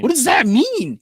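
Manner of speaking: high, loud baffled question; baffled exclamation. Retold or imitated. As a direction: act out loud bewilderment. Acted baffled reply in retold exchange.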